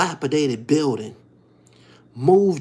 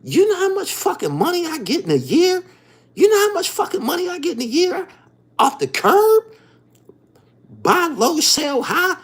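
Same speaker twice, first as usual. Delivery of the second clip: much higher and louder, faster, scoffing at the rules; heated inner retort; 4 dB louder than usual. Retold or imitated. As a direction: fire it back like you can't believe they asked. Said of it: "I'm saying to myself".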